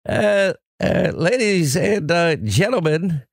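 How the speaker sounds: high, slow radio-host impression; Bob Grant. Host addressing ladies and gentlemen as the radio veteran, high slow impression. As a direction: draw it out high, with halting pomp. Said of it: "Bob Grant is very good".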